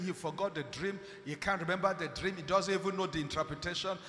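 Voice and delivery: ordinary talk, nothing marked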